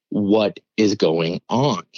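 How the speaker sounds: slow, separated words of disbelief; retold bewilderment. Storyteller reacting to foster kids' news, slow and baffled. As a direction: drag out each word, baffled.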